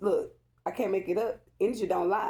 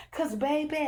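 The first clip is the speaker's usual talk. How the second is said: giddy laugh; 11 semitones higher than usual. Real, not acted. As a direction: giggle then coo. Still giddy after the joke.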